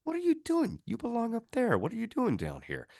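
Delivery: high, cooing pet voice; tour guide coaxing a pet. Retold or imitated. Tour guide coaxes the ghost like a dog, high cooing. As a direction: coo at it like a lost puppy. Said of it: "he just goes".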